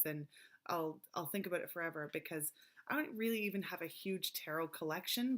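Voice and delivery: ordinary delivery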